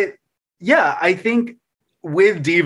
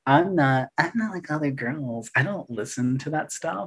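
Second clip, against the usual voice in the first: mock snob; 6 dB quieter than usual. Mocking the not like other girls pose.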